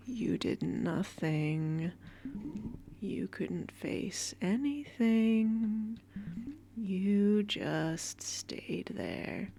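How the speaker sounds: slow, eerie whisper of a cruel inner voice; dark inner voice taunting a scared child, slow and whispered. Acted. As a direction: drag each word out like a haunting thought. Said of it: "What, what is that voice?".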